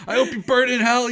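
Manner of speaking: high, loud hammed-up shouting; mock furious shout. Joining the yelling man cursing Roy, a mock shout. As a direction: hurl the curse at him.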